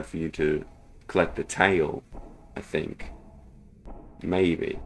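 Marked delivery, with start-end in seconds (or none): none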